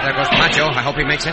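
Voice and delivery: loud, high call over the gunfire. Acted. Cisco watching his partner break for the horses, loud call. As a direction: shout it across the noise of the shooting.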